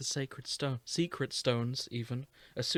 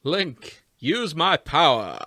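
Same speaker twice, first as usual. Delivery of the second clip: loud, pompous, booming prince; Matt Berry voice; 10 dB louder, slower than usual. Retold or imitated. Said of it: "Do not Matt Berry Prince Sidon".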